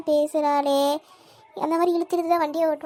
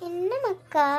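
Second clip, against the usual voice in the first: ordinary talk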